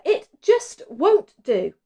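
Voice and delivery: high, slow, whiny complaint; moaning Martha. A narrator's reading. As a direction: whine it like a fed-up sister. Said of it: "moaned Martha".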